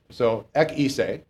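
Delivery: "ek eise" said slowly, each Greek word apart; Greek words spelled out. Shown as a demonstration. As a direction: sound out "ek" and "eise" slowly for the class. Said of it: "ek in the Greek".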